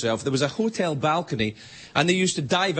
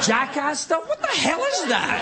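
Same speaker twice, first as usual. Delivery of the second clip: high-pitched comic outrage; exasperated rant with the voice shooting up; exasperated disbelief; 10 semitones higher than usual. Acted. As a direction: demand an explanation, utterly baffled. Ranting about extreme sports.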